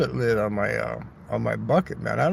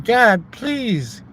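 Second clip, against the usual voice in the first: mock prayer; 8 semitones higher, 4 dB louder, slower than usual. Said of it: "I was saying".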